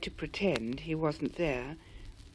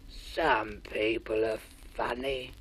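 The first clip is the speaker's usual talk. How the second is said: lower, slow sighing creature; low squamp musing; 8 semitones lower, 3 dB quieter, slower than usual. A narrator's reading. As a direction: muse sadly to himself. Squamp muses sadly.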